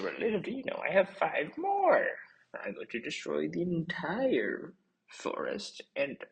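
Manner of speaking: cartoon baddie crowing his evil plan; gloating villain. Villain gloats over captured bunnies.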